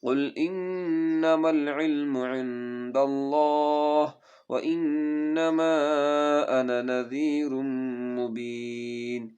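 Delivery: melodic chanted tajweed recitation; Quran recitation. Shown as a demonstration. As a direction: recite slowly and musically, stretching vowels.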